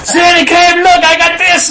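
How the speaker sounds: high, loud and laughing; excited child. Retold or imitated. Boy shows dad his toy, high and excited. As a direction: show off the toy, bubbling over.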